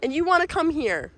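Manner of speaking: high, loud, eager calls to a dog; bright excited pet-owner voice; excited dog calling. Real, not acted. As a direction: call your dog over, thrilled by the view. Excitedly calling her dog over.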